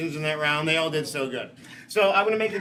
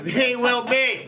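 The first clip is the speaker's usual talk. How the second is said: slow, higher showman's lead-in; crowd cue; 6 semitones higher, 4 dB louder, much slower than usual. Pretend. Host cues audience to finish the foul, drawn-out crowd cue. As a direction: draw it out to cue the crowd's shout.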